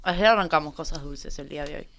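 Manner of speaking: candy in the mouth; sucking candy. Real, not acted. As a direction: speak around a caramel. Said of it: "termino de tragar todo el caramelo".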